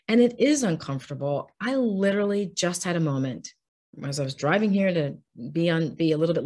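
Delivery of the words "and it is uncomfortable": usual speaking voice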